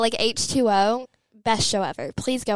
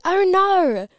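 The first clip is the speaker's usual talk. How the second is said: high, louder mock-Australian "oh no"; Australian accent; 10 semitones higher, 5 dB louder than usual. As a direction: cry out in exaggerated Australian. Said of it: "it's a habit at this point".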